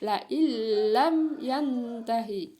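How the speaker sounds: LA IN LAM drawn slowly as "la illam", l held; doubled-letter reading. Shown as a demonstration. Teacher demonstrating the doubling, slow held l. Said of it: "dan mentasjidkannya".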